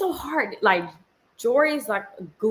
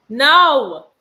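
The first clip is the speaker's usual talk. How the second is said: loud, higher, emphatic no; firm refusal; 5 semitones higher, 11 dB louder than usual. Real, not acted. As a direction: shut it down loudly. Refuses her child out loud.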